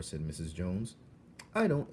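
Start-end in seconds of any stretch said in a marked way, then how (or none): 1.5-1.9 put-on child's admission. Coraline admitting.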